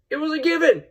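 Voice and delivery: usual speaking voice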